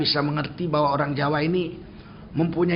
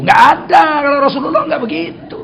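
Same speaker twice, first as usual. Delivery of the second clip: higher and louder, laughing as he shouts; laughing emphatic shout; 7 semitones higher, 8 dB louder than usual. Real, not acted. Preacher laughing and shouting to the crowd that the Prophet was never roundabout. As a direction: blurt the denial through laughter.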